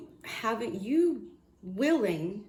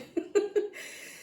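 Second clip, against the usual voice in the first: breathy laugh; 13 semitones higher than usual. Real, not acted. After the shocked reaction.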